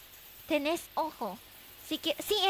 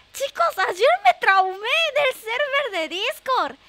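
higher and louder, worked up; excited complaint; 6 semitones higher, 6 dB louder than usual. Real, not acted. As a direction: tell it all worked up. Exclaims about Discord trauma. (queja exaltada)

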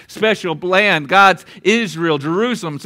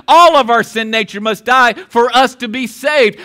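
rising, louder preacher's build; preaching; 2 semitones higher, 5 dB louder than usual. Acted. Preacher driving the point home to the congregation, louder. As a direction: pound it home from the pulpit.

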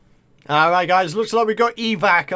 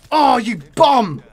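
exasperated shout; 6 dB louder, slower than usual. Real, not acted. Gamer insults killer in game.

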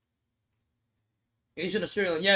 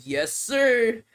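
mock yes sir; 6 semitones higher, 6 dB louder than usual. Pun on name Mohammed Yasir.

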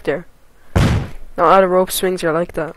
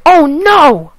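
loud, high cry of dismay; alarmed cry; 8 semitones higher, 9 dB louder than usual. Real, not acted. Gamer watching a fall, alarmed cry. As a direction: cry out as it all goes wrong. Mishap in the game.

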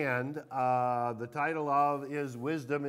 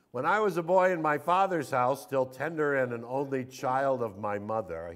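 louder, proclaiming Bible reading; emphatic scripture reading; 6 dB louder than usual. Acted. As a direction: read the father's words out boldly.

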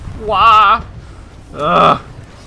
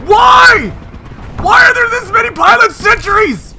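high, loud exasperated yelling; frustrated shout; 7 dB louder than usual. Real, not acted. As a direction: yell in disbelief at the screen. Frustrated at pilot sentries.

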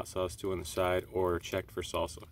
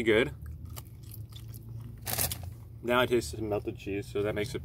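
chewing while talking; speaking between bites; mouth full. Real, not acted. Seen 0: bites the food at 0.9, chews and talks through 5.3.

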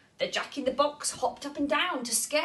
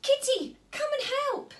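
high calling out for help; urgent call. Acted. Clara calling Kitty to help her. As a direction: shout across to Kitty.

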